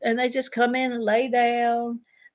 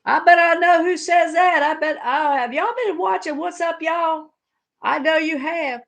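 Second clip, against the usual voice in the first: teasing friends voice; 8 semitones higher, 4 dB louder than usual. Retold or imitated. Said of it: "And everybody go".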